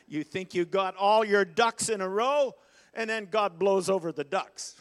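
preacher whipping up the crowd, loud and high; fired-up preaching. Real, not acted. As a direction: drive the punchline home to the congregation. Preaching climax.

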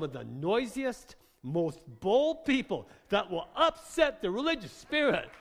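emphatic prophetic sermon, swelling in force; fiery preaching. Acted. Preacher declaring a noisy, bold people, fiery preaching. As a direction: preach it boldly, punching key words.

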